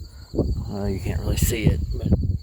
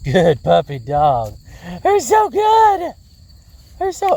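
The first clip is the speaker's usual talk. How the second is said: dog praise voice; 8 semitones higher, 4 dB louder, slower than usual. Real, not acted.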